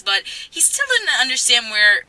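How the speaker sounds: ordinary talk, nothing marked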